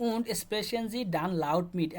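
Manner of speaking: German instruction read out word by word, very slowly; teacher reading the German task aloud for students, very slow. Shown as a demonstration. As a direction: spell the German out slowly for learners. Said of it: "শোনার পরে আপনাকে জোরে জোরে বলার জন্য".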